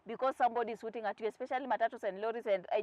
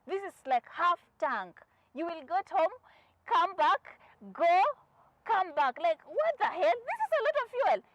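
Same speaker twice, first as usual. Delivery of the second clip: emphatic, spaced out, climbing higher; exasperated colleague; 6 semitones higher, 4 dB louder than usual. Retold or imitated. Colleague to panicking driver with half a tank, exasperated disbelief. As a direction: build from slow insistence to a high-pitched protest.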